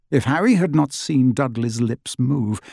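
ordinary delivery

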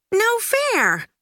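put-on high childlike protest cry; Judy blurts it out, indignant; upset outcry. A narrator's reading. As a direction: kid crying foul. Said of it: "cried Judy".

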